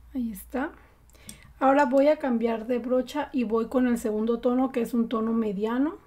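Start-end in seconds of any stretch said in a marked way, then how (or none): 0.1-0.7 breathy whispered aside; soft whispered satisfaction. Real, not acted. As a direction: whisper it to yourself as the blend settles. Quietly finishing the blend.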